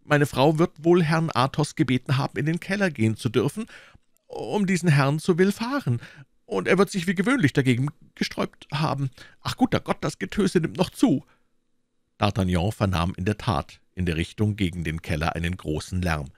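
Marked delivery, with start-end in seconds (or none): 0.0-11.2 high, agitated innkeeper's voice; fretful innkeeper. Acted. Innkeeper tells of the racket in his cellar, fretful. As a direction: wail it nervously, high-pitched.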